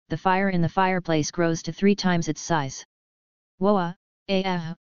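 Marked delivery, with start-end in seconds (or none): 3.6-4.7 startled exclamations, fading out; a sudden shocked woa; shocked gasps. Acted. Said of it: "shocked voices".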